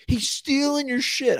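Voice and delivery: high, slow outraged buddy. Retold or imitated. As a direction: squeak it out like an indignant pal. Buddy's text voiced.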